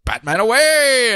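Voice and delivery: hammed-up catchphrase, stretched out slowly; heroic exit cry. Batman running off to distract the troops, heroic exit cry.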